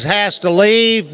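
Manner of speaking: usual speaking voice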